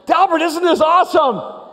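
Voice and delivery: high, loud thrilled question; excited shout. Retold or imitated. Friends calling to Delbert on the ride, loud excited shout. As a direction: yell it over the ride noise.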